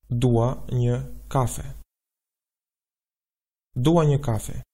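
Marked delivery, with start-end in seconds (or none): 0.1-1.6 slow, deliberate Albanian phrase; slow model answer. Shown as a demonstration. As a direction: say it slowly for learners. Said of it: "Let's put it all together".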